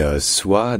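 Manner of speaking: ordinary talk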